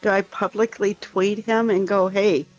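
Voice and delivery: ordinary talk, nothing marked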